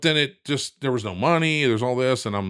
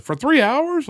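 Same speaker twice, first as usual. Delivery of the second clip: imitated wife's shocked question, much higher and louder; astonished; 6 dB louder, slower than usual. Wife shocked at how long the call lasted, high astonished question. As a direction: squeak it out in disbelief.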